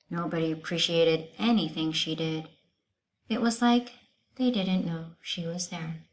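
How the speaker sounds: ordinary delivery, nothing marked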